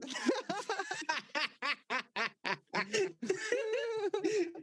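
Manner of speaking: long high laughing fit; real laughter. Real, not acted. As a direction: let the laugh roll on. Laughing at sleigh story.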